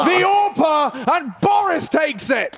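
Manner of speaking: excited caster shout. Acted. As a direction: shout the clutch win.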